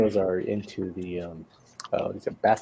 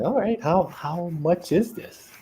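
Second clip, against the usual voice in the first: high-pitched, slowing retold question; tentative question; 8 semitones higher, slower than usual. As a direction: mildly act out an unsure customer. Retold hesitant question in shop.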